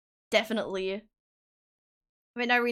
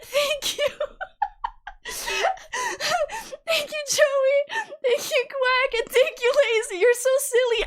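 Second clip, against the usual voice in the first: laughing thanks; 12 semitones higher than usual. Real, not acted. Thanking donors while laughing.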